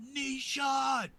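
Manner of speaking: sharp high shout across the room; battle yell. Acted. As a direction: yell it out as you fire. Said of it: "I, I yell out".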